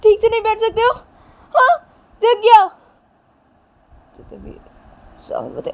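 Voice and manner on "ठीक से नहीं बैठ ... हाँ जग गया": much higher and louder, an angry scolding yell; furious shout. Acted. Wife screams angrily at husband for waking the baby. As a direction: blast him for waking the baby. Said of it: "गुस्से से देखने लगता है".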